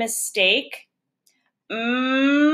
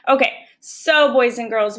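usual speaking voice

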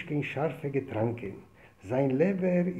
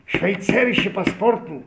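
high, loud and slow; loud repeat; 4 semitones higher, 7 dB louder, much slower than usual. Retold or imitated. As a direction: spell out Schweizerische Passporten slowly and loudly. Officer repeats it loudly. (שווייצערישע פאספארטן הויך און לאנגזאם)

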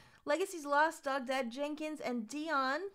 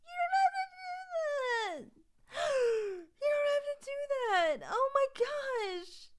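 very high, whiny near-crying plea; overwhelmed protest; 10 semitones higher than usual. Real, not acted. Streamer begging viewers to stop gifting, high overwhelmed wail.

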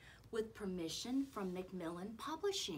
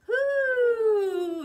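loud, high, long hoo; drawn-out owl call; owl hoot; 15 dB louder than usual. Pretend. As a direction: finish with one big owl hoot. Closing owl hoot of song.

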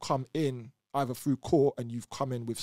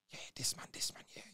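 whispered, softer aside; whispered vouching; 8 dB quieter than usual. Retold or imitated. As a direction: murmur it like passing a word along. Inmate vouching quietly.

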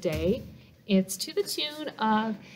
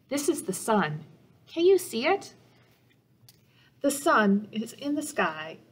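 put-on reading to children. A narrator's reading. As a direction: read it gently to little listeners, asking them questions. Reads picture book to children.

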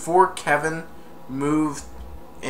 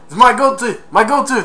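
dismayed burglar; 10 semitones higher, 11 dB louder than usual. Retold or imitated. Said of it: "And he said".

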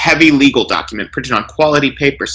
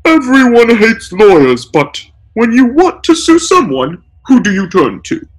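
tearful; 8 semitones higher, 4 dB louder than usual. A narrator's reading. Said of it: "tearfully interrupts".

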